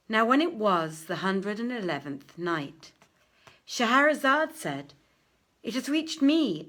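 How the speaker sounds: grand read-aloud storytelling; story narration. Acted. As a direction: tell the tale out to the room like a bard. Reads the tale aloud.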